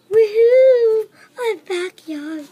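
high, loud whoop of joy; bouncy excited shout; excited cheer. Pretend. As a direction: cheer it out like a thrilled kid. Cheering while showing backyard.

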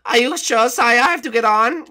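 high, loud whiny servant voice; whiny guard. Acted. Woken by his captain, a high loud whiny guard. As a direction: whine up high at being woken.

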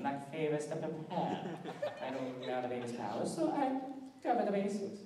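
put-on bossy fashion designer with a clipped foreign accent; brisk and imperious, calling everyone darling; Edna Mode. The designer showing off the baby suit, imperious and brisk. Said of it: "Edna Mode from The Incredibles".

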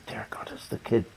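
hushed, breathless dread at the noise; an old man's startled whisper; alarmed whisper. Retold or imitated. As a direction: whisper it like you're caught out. Grandfather panics at crying kid.

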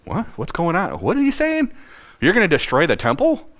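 can't believe what he just heard; baffled disbelief. Retold or imitated. Puzzled crowd hearing Jesus foretell the temple's fall, incredulous. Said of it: "scratching their heads like".

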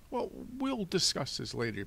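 high-pitched, fussy banker; fussy Mister Poe, dismissive. Acted. Banker postponing the lock discussion, fussy. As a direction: put it off in a high official voice.

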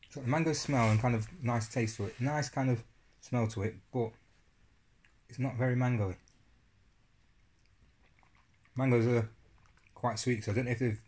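spoonful of yogurt in mouth; speaking between bites; talking while eating. Real, not acted. Seen 3: spoon repeatedly at mouth, eating from cup throughout speech.

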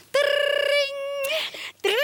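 alarm bell imitation. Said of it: "an alarm ... that goes".

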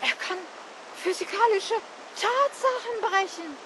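stunned, word by word with breaks; gasping disbelief. A narrator's reading. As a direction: struggle to get the words out. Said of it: "schnappt Luft, versucht zu sprechen".

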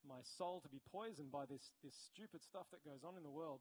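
ordinary delivery

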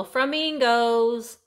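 "flamingos" said slowly as "frumingos"; dad's pronunciation. Real, not acted. Presenting the little shakers with dad's word, "flamingos" said as "frumingos". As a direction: swap fl for fr in "flamingos": "fru-mingos". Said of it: "typically what I call them now".

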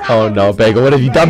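low-pitched mock lament; low dismay. Player reproaching Bagel for a mistake, low mock dismay.

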